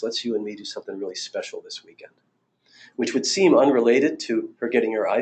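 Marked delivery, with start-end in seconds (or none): 0.0-2.1 much softer, gentle retelling; soft tender quote. Retold or imitated. Husband softly asking his wife for a special weekend. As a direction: soften right down as if looking her in the eyes. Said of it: "Then he got real soft".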